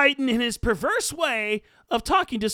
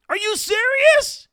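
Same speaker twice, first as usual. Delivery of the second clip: high, loud, slow incredulous cry; outraged disbelief; 10 semitones higher, 6 dB louder than usual. Real, not acted. Host rants at [name] voters, loud incredulous outburst. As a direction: let the disbelief burst out.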